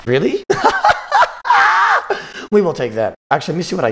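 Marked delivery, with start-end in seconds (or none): 0.5-2.5 shrieking burst of laughter; high-pitched laugh. Real, not acted. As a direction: laugh in delighted disbelief. Opponent's surprising move.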